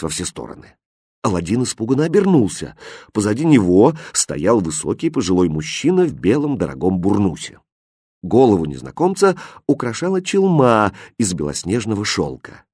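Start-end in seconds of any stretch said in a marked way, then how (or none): none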